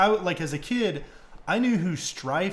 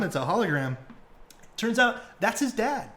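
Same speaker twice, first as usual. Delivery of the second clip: ordinary talk, nothing marked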